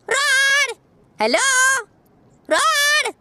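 high, carrying call to the enemies; playful high-pitched call. Real, not acted. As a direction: sing out their names to taunt them. Calling enemy to come.

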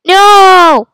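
loud, high no; outcry. Real, not acted. Player failing a jump, shouting loud. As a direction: shout it at the screen.

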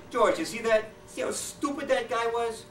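high, slow, gloating question; Cheney gloating to Bush, high and slow; smug Cheney. Acted. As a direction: savor how dumb he was. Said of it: "Cheney says to Bush".

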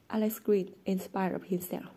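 usual speaking voice

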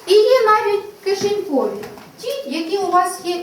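high, loud delighted show-and-tell; lively reveal. Shown as a demonstration. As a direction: surprise the class with it. Teacher excitedly shows pocket calendar. (радісно, голосно і високо)

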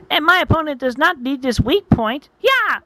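high anime fighter, rising to a yell; attacking challenger. Acted. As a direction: taunt high, then burst into a battle cry. Maipo attacks Sani's tail.

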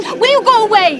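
loud, exasperated shout; angry plea. Acted. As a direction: send the brother away in fury. Sister tells brother off.

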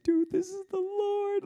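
overwhelmed with wonder; freaking out. Retold or imitated. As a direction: squeal it out in amazed excitement. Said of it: "started freaking out".

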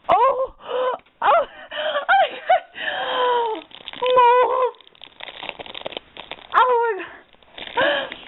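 real gasping squeal, overcome; overwhelmed by a gift, squealing with joy; excited shriek. Real, not acted. Discovers the mold, screams.

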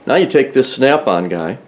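normal talking voice

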